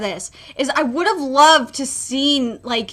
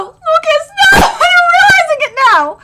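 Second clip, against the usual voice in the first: much higher and louder, voice breaking into a laugh-sob; tearful shout; 7 dB louder than usual. Real, not acted. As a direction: shout his name through real tears. Re-reading the tragic panel.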